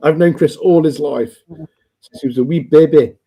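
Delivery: light sing-song Welsh lilt; Welsh accent. Retold or imitated. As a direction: put on a Welsh voice. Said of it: "That was a very impressive Welsh accent".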